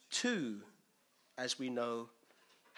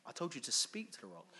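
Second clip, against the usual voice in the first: a lightly voiced exasperated mate saying bruv; London slang God; 7 dB quieter than usual. God telling off Moses, lightly voiced as a London mate. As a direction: deliver it as a casual annoyed friend, only lightly done. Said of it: "he didn't say it like this".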